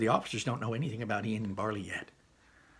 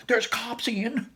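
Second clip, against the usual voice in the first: high, raised voice in alarm; alarmed warning; 12 semitones higher, 4 dB louder, slower than usual. Acted. Brother warned about cops behind them, alarmed. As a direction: blurt out an urgent warning.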